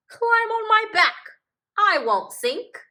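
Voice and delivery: loud, bright-hearted hippo voice; cheerful hippo. Acted. Hippo reassures the cub who can't swim, loud and cheerful. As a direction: brush off the worry with big cheer.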